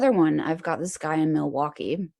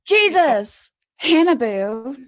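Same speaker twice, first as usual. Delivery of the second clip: startled exclamation; 7 semitones higher, 7 dB louder, slower than usual. Real, not acted. Sudden startle at the table.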